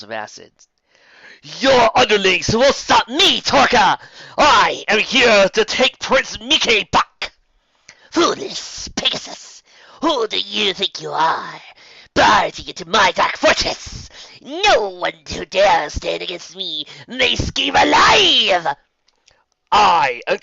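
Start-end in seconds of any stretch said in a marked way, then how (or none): 1.6-7.3 high, loud, defiant battle voice; defiant heroine. Acted. Pegasus heroine defies the evil enchantress, ringing high shout. 8.1-18.8 high, loud, slow sneering threat; menacing villain. Acted. Enchantress scorns the barging Pegasus, slow loud menace.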